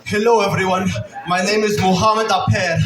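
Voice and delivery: mock Palestinian accent, introducing himself; put-on Palestinian accent. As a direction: fake a Palestinian newcomer's accent. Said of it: "I actually don't talk like that".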